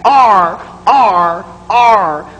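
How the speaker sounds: R said with the tongue pulled back; repeated retroflex R; English R demo. Shown as a demonstration. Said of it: "the tongue back".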